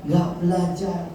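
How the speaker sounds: usual speaking voice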